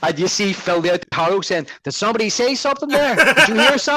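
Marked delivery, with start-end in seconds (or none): 1.8-4.0 much higher, mock-innocent taunt; teasing, higher mafia impression; mocking gangster. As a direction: needle him like a smug mob boss. Imitating Phil Leotardo's taunt.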